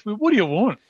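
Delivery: lightly acted rude demand; rude assistant. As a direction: imitate a put-out assistant. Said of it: "there is another rude one".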